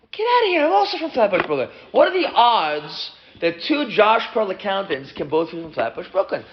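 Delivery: high incredulous outburst; amazed excitement. Acted. Namesake learns both are from Flatbush, amazed excitement. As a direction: start very high, then stretch out the disbelief. Discovering same hometown, acted amazement.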